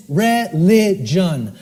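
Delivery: "religion" drawn out as reh-lih-jun; "religion" very slow, each syllable separate; syllable-by-syllable word. Shown as a demonstration. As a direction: break "religion" slowly into its syllables. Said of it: "R E L I".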